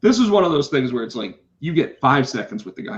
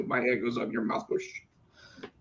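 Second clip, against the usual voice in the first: muttered teacher rule; 2 semitones lower, 13 dB quieter than usual. Retold or imitated. Repeating the teacher's rule to himself, muttered. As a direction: repeat the rule quietly to yourself. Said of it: "that's what teachers do".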